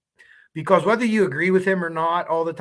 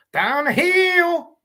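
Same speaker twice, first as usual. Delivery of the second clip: high, very slow drawn-out "Down the hill"; Ron Logan impression; 13 semitones higher, 5 dB louder, much slower than usual. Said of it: "maybe do a Ron Logan".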